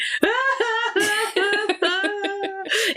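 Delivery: imitated wail; mock toddler wail. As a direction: squeal like the upset toddler. Toddler's horrible noise.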